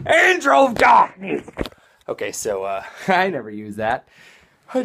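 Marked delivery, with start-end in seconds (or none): 0.1-1.5 booming high mock-heroic yell; epic title shout. Vlogger proclaiming his own epic title, shouted. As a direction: announce yourself like a dark superhero.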